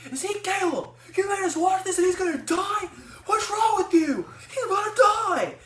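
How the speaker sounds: mock yelling crowd. Family in the theater yelling at her, high loud mock shouting. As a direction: shout like betrayed moviegoers, slowing on the last lines. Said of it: "started yelling at me".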